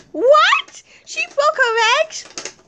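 very high, rising disbelief; shocked. Pretend. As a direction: gasp out the news as a drawn-out question. Shocked at news of broken legs.

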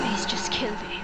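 hushed, desperate prisoner pleading; whispering prisoner. Acted. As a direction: beg for help in a whisper. Voices imprisoned man from game.

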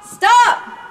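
loud firm yell; shouting. Shown as a demonstration. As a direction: shout to scare them off. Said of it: "using our voice".

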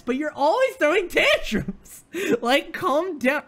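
high-pitched outburst breaking into a laugh; exasperated scolding. Acted. Viewer telling tantrum-throwing Danny off, laughing exasperation. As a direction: rant upward in mock outrage.

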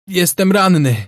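high, loud yell. A narrator's reading. Wounded sailor yells to his comrades from the bay. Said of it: "wrzasnął marynarz".

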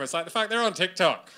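usual speaking voice

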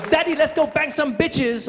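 put-on high baby voice, English accent; cheeky little rocker with an English accent; mock English accent, pitched high. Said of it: "He has an English accent".

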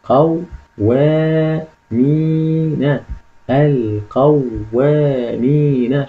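القوامين very slow, split into its parts with long vowels; syllable-by-syllable reading. Shown as a demonstration. Teacher shows how analysis helps read correctly, slow syllable-by-syllable reading. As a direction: sound out القوامين piece by piece, holding the long syllables. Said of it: "أقرأ الكلمة قراءة صحيحة".

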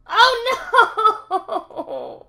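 sudden high yelp of dismay; loud high oh no breaking into a laugh; startled cry. Real, not acted. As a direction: shriek as you slip, half laughing. Falls off the parkour.

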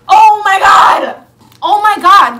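high, very loud exclamation of delight; excited shouting. Real, not acted. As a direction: shout with real, overjoyed disbelief. Thrilled by unboxing find.

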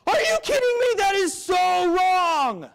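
very high, loud, slow outraged yell; outraged roommate. Retold or imitated. Roommate yelling at Ryan, high slow outraged shout. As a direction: shriek it in outrage, stretching it out. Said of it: "He goes".

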